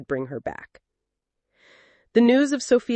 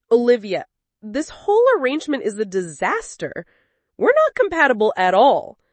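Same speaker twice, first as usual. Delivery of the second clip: higher, louder fed-up husband; angry complaint; 5 semitones higher, 3 dB louder than usual. Acted. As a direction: snap it out, building to louder. Quarrel between swapped partners.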